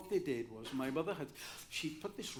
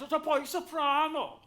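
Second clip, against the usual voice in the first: higher lilting Welsh teacher; 9 semitones higher, 6 dB louder than usual. Retold or imitated. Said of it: "they all speak like this".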